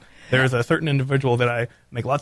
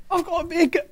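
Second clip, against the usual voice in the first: high lady voice; man doing a woman's voice. Acted. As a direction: squeak it like a lady. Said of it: "girl voice".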